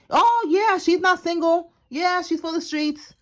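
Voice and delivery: high, sing-song mock of the liar; mocking gossip voice. Tarot reader mocking the liar who called her single, high sneer.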